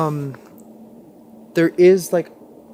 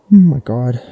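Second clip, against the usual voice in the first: loud lower shocked oh my god; real fright, much louder; shocked gasp-like cry; 4 semitones lower, 12 dB louder than usual. Real, not acted. Thing finally said something.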